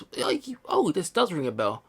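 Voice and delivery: normal talking voice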